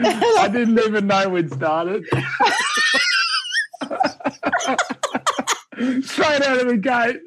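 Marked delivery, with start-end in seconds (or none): 0.0-2.1 laughing through the words, a little higher; amused, caught off guard. Real, not acted. Caught out by the recording. 2.1-6.0 high shrieking laugh. Real, not acted. Bursts out laughing.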